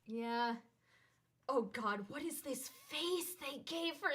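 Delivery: ordinary delivery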